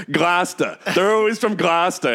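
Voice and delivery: put-on "Gloucester" said as "Glahsta" in a Boston accent, no r; Boston-accent "Gloucester", with a flat a and the r dropped. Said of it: "And he's just like".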